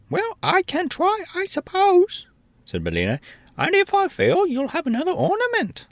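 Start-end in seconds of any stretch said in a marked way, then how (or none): 0.1-2.3 hen voice. A narrator's reading. Billina voiced. 3.6-5.9 hen voice. A narrator's reading. Billina voiced.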